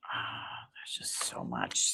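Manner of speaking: breathy hushed whisper; slow, voiceless murmur. Real, not acted. Whispered aside before the voice.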